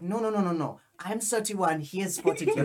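put-on silly German accent, starting high; strong German accent. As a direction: insist in a stupid German accent. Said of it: "I had a really strong, stupid accent".

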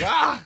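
short high-pitched "ah!"; high mock scream; mock alarmed yelp. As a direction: let out a comic yelp of alarm. Mock reaction to odd news story.

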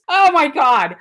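high, loud exclamation of dismay; mock outrage. Friend objecting to a doll being given away, mock outrage. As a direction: imitate a scandalised friend.

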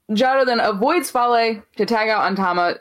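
ordinary delivery

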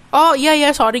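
apologetic blurt, imitating herself; flustered apology. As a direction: act out a hasty, startled sorry. Acted hurried apology after being scolded.

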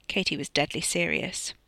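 plain reading voice

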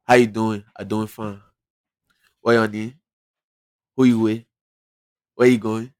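light Liberian broken English; a touch of thick Liberian accent; Liberian colloquial English. Shown as a demonstration. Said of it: "it gets a little deeper when you start saying".

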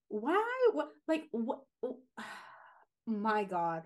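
exasperated questions sinking into a sigh; acted exasperation. Voices own exasperated reaction to posts.

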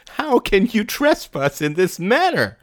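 high, giggly question; giggling. A narrator's reading. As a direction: tease her with a giggle. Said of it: "giggled Mary".

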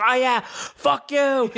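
high, drawn-out mock yell; retold yell. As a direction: imitate a man yelling a taunt, stretching it out. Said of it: "he starts yelling that".

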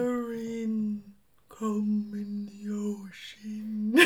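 very slow, drawn-out whale call. Pretend. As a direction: stretch every word like a whale singing.

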